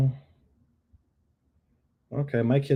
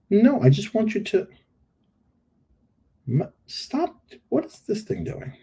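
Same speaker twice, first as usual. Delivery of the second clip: exasperated at the tool; 10 semitones higher, 5 dB louder than usual. Real, not acted. Editing tool misbehaving.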